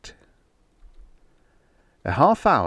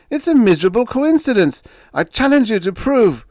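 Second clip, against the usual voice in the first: much higher, louder and fast; panicked scream; 9 dB louder than usual. Acted. Ruined writer screaming at his editor. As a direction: scream desperately. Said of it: "Harmer screamed".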